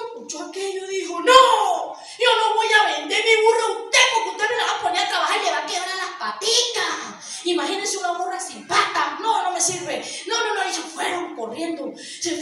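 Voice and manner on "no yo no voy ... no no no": shouted no, then insistent; boy turning down the market owner, loud and insistent; loud refusal. Acted. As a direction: refuse loudly, shaking your head.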